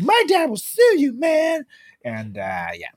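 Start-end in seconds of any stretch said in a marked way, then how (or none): 0.0-1.6 high, loud, whiny mock threat; entitled frat bro. Frat bro threatening to sue, hammed-up entitled shout. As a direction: yelp it like a spoiled douchebag. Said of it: "be that kind of person".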